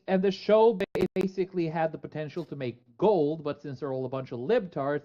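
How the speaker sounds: ordinary talk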